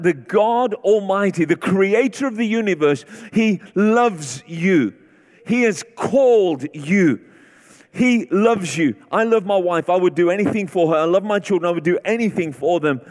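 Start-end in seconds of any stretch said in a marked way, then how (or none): 0.0-9.0 drawn out and weighty; slow emphatic preaching. Acted. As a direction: let each phrase land. Emphatic build in sermon.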